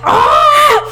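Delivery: high, loud re-enacted scream. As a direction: scream as if fleeing a roach. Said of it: "I would start screaming".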